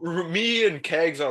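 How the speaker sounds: ordinary delivery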